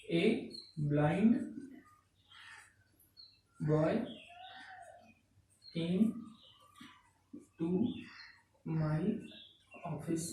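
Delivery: soft, one word at a time with long pauses; word-by-word dictation. Real, not acted. Teacher writing out an example for students, halting word-by-word dictation. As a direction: say each word slowly as you write it out.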